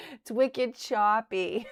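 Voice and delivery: slow, laughing Boston "it's wicked choppy"; laughing Boston accent. Shown as a demonstration. Streamer showing how her Boston accent pops out, laughing. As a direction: giggle through the Boston phrase. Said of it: "sometimes it pops out".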